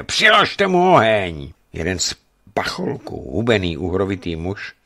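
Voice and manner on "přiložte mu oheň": higher, louder and slow menacing order; furious official ordering torture; threatening shout. A narrator's reading. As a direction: drag out the command to torture him. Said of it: "zvolal výhružně".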